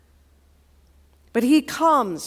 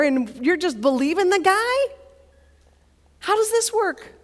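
higher-pitched disbelieving friend; incredulous buddy; 5 semitones higher than usual. Acted. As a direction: question him in exasperated disbelief. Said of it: "he had a buddy who was with him".

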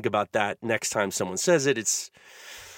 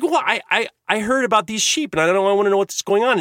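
high, stammering explanation; flustered young boy; 8 semitones higher, 4 dB louder than usual. Retold or imitated. Boy explains to his dad, high and stammering. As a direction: blurt it out like a curious kid. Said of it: "And I said".

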